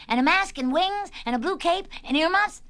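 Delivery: high, loud eager question; excited shouting. Acted. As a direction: shout it out like a crowd of excited children. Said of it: "Everybody shouted at the same time".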